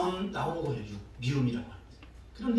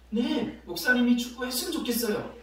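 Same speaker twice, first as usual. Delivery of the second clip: lightly voiced child, higher pitch; a touch of an eager kid answering; child's reply; 7 semitones higher than usual. Girl answering pastor's challenge, childlike yes. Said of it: "그랬더니".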